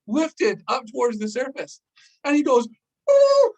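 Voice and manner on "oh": much higher, much louder cry of alarm; sudden panic as his eyes go wide. Retold or imitated. Friend realizes tank floating away.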